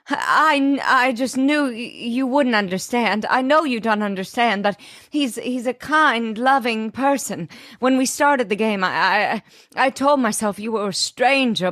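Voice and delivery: high, stammering melodramatic plea; hammed-up emotional plea. Elizabeth defending Jack to Chuck, melodramatic and high. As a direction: overact a trembling heartfelt plea.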